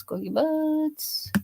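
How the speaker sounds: high, slow and soft; singsong. Shown as a demonstration. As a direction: croon it slowly. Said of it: "repeats phrase high and slow".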